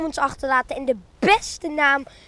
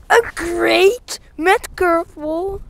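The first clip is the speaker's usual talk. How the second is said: incredulous; 3 semitones higher, 5 dB louder, much slower than usual. Real, not acted. Outraged at bad throw.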